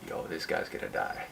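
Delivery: breathy retold whisper of alarm; hushed whisper. Friends whisper to each other after a shoulder check, hushed. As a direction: whisper it like trouble is coming.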